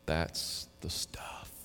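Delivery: slow whisper; hushed, slow whisper. Real, not acted. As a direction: breathe it out slowly. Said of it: "whispered aside".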